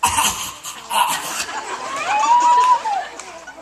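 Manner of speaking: squealing laugh; high burst of laughter. Real, not acted. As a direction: break into high helpless laughter. Breaks up mid-scene.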